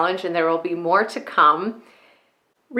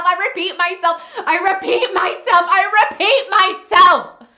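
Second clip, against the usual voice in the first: building monologue; 8 semitones higher than usual. Acted. Woman who carries too much repeats herself, building desperation.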